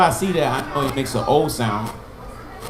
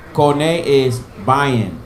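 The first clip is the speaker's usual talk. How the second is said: slow and deliberate, each syllable apart; slow teaching read; much slower than usual. Shown as a demonstration. Teacher slowly links the Hebrew word to its meaning. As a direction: stretch it out so students catch it.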